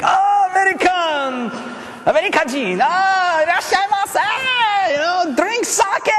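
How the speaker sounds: put-on high, loud, excited Japanese-accented welcome; Japanese hosts greeting. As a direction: stretch the greeting out slowly like an eager host. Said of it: "and they're like".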